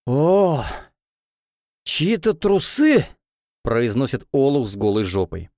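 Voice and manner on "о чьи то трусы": put-on high slow drunken exclaim; drunk frat bro. Drunk guy finds underwear.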